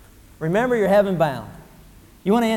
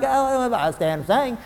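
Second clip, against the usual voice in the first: slow, grudging sigh of a reply; mock-unwilling stand and sing; reluctant churchgoer; 3 semitones higher, slower than usual. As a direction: drag it out like it's a chore. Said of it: "You people are like".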